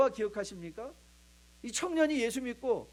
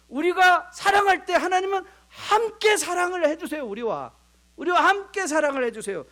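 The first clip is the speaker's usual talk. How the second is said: higher and louder impassioned appeal; fervent preaching; 9 semitones higher, 7 dB louder than usual. Real, not acted. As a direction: preach it with full heart. (높고 힘차게 외치는 설교)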